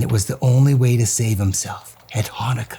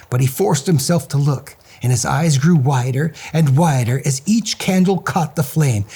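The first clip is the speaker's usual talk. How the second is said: ordinary delivery, nothing marked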